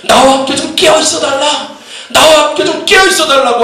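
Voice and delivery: high, urgent pleading as Jesus; imploring preacher's re-enactment; anguished plea. Retold or imitated. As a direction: beg with rising intensity. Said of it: "심히 고통스럽고 고민되고 괴로워서".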